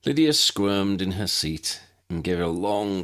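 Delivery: usual speaking voice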